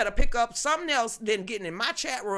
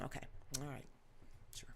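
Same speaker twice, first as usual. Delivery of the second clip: normal talking voice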